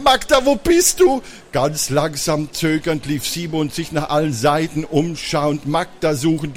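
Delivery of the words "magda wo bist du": put-on high, scared boy calling out; anxious calling. Brother searches the hall for his vanished sister, anxious calling. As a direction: call for her with rising fear.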